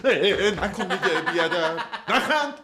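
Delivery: high, loud giggling pleas to stop; laughing through a playful tussle; laughing protest. Acted. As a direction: squeal and laugh while fending him off.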